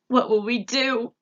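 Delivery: high, loud lament; high, loud wail of despair. A narrator's reading. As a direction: cry out helplessly. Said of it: "wailed the cook".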